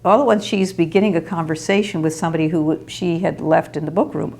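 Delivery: ordinary talk, nothing marked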